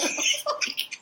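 high unvoiced giggle; breathy laugh. Real, not acted. Laughing at her own impression for her cohost, airy giggle. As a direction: let a breathy giggle slip out.

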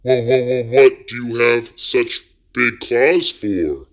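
high, stuttering frightened voice; scared boy asks the creature, stammering; stammering in fear. A narrator's reading. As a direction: stutter it out terrified.

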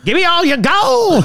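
high, hollering prospector voice; hammed-up high prospector; mine prospector. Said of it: "That's, that's closer".

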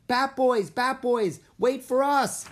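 put-on high, loud kid's holler; eager, urgent calls from behind; shouting after someone. A narrator's reading. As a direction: shout to be waited for. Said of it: "someone shouted".

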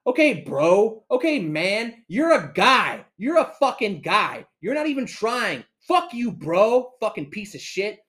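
high, loud taunting rant; retold rage. Customer going off at the clerk who spat, re-enacted rage. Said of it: "initially rage, and then that rage".

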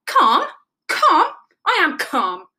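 loud, high, irritated echo; testy snapping, climbing then dropping; snappish. A narrator's reading. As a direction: insist on being calm while losing it. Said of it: "snapped Rabbit".

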